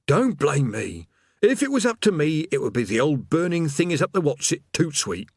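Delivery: put-upon demon moaning; grumbling demon. A narrator's reading. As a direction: grumble off script, disowning the blame.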